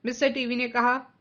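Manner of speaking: usual speaking voice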